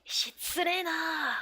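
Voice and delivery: high, drawn-out indignant protest; mock offended. Customer calling Yotsuba rude, high and mock offended. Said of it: "saying you're being rude".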